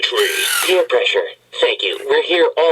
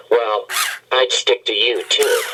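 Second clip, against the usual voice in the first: usual speaking voice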